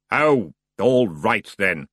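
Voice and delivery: slow, weary boatman; reluctant giving in; grudging ferryman. Acted. The ferryman gives in to the Feegles, grudging.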